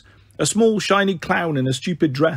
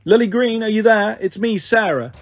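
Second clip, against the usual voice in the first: calling out; 6 semitones higher than usual. A narrator's reading. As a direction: call to her through the door. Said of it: "she called".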